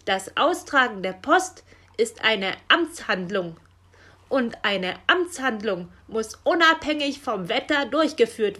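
loud, emphatic officialese with shouted caws; sharp reprimand. A narrator's reading. Raven rebuking Frau Elster about official duty, sharp reprimand. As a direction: lay down the law, building to a shouted caw. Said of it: "wies Meister Schwarzrock sie zurecht".

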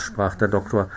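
ordinary delivery

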